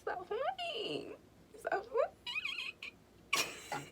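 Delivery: soft, whispered scolding of herself; hushed aside. Real, not acted. Scolding herself mid-story in a whisper. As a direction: mutter it under your breath.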